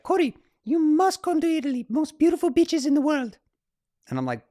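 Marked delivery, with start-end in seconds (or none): 0.0-3.4 very high, loud mock Italian accent; Simone Moro. Italian climber in the tent inviting Corey to Italy, high imitated voice. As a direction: play an Italian climber gushing in a high caricature. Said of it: "Simone has this voice that is just epic".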